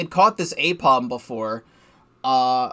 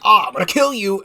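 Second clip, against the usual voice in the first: louder mock battle cry; mock enemy threat; 5 semitones higher, 6 dB louder than usual. Yiga enemy lunging at player, hammed-up threat. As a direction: ham up a goofy ambush threat. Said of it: "they're just like".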